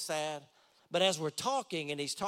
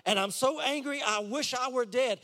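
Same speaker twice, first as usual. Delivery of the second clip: higher, louder angry outburst; Jonah, angry and despairing; 8 semitones higher, 4 dB louder than usual. Acted. Jonah answering God about the plant, an angry outburst. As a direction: let Jonah's frustration burst out. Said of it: "Jonah's just honest response".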